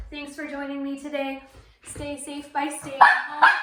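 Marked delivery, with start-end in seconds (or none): 1.8-3.5 loud slow sign-off. Shown as a demonstration.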